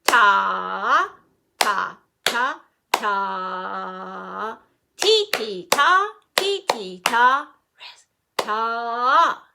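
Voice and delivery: loud forte chant of the rhythm syllables; strong loud counting. Shown as a demonstration. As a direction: belt the ta ti ta out.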